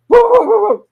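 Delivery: loud, rapid-fire mock barks; string of human-made woofs; dog barking. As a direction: bark like a dog in one quick volley. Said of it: "'cause I'm barking".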